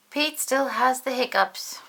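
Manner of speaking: ordinary delivery, nothing marked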